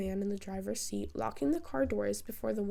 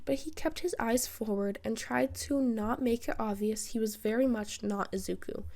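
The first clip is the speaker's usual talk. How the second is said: plain reading voice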